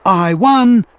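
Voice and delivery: proud little engine peep of victory; triumphant peep. A narrator's reading. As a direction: crow about the win. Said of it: "he peeped".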